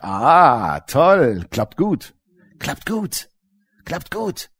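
fading sarcastic devil; sarcastic gloat fading away; mocking devil.

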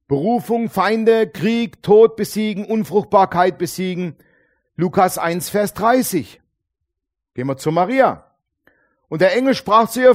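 loud, driving preacher voice; forceful preaching. Acted. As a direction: preach it out to the crowd. (mit voller Kraft gepredigt)

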